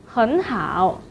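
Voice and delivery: 很 rising before a low 好 in 很好; tone-change demo. Shown as a demonstration. Teacher introduces very good, second tone then third. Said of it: "changed to the second tone".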